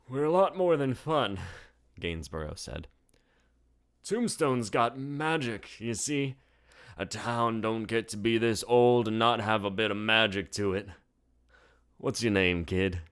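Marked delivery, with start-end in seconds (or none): 0.1-1.4 put-on higher old-timer voice; proud old bartender boasting; old barkeep. A narrator's reading. Bartender telling first-timers the saloon's age, higher old-timer voice. Gainsborough voice. 4.0-13.0 put-on higher old-timer voice; proud old bartender boasting; old barkeep. A narrator's reading. Bartender telling first-timers the saloon's age, higher old-timer voice. Gainsborough voice.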